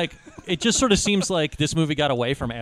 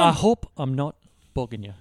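mock Irish accent; 5 semitones lower than usual. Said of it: "Brad Pitt's accent".

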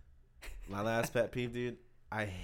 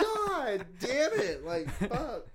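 exasperated; 13 semitones higher, 6 dB louder, much slower than usual. Retold or imitated. Frustrated at mom again.

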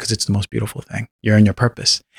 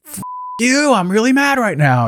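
sharply higher, imitating a furious snap at the boss; mock angry outburst; 10 semitones higher than usual. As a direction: act out telling the boss off, pitched way up. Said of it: "Oh, I actually wanted to go like".

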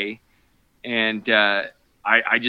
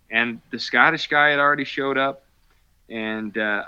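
usual speaking voice

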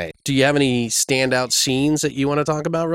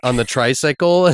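usual speaking voice

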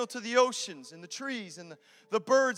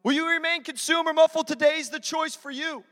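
loud, driving altar-call preaching; preaching at full force; 7 dB louder than usual. Acted. Pastor urging the congregation to accept Jesus, full-force preaching. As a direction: press the room hard toward a decision.

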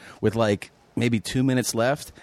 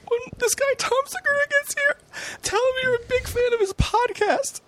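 much higher giggly voice; high tipsy giggle voice; imitating the friend. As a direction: play the giggly drunk buddy plotting a prank. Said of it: "He goes".

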